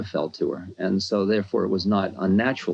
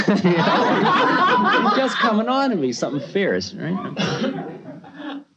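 talking through laughter, much higher; laughing; 14 semitones higher, much slower than usual. Real, not acted. Speaker laughing as he tells the audience about the guru, amused. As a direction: giggle out the words.

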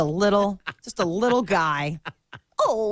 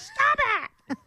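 put-on baby whine. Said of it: "You're like".

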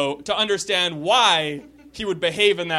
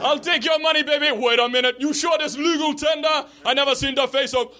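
put-on Jamaican accent; 7 semitones higher than usual. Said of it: "admiring my Jamaican accent".

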